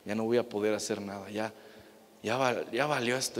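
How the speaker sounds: a hushed, defeated sigh of a father giving up; despairing father. Acted. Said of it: "Jairo decayó". (padre derrotado, en voz baja y hundida)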